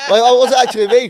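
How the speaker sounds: talking through real laughter; laughing. Real, not acted. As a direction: giggle through the words, fast. Admits being drunk, laughing.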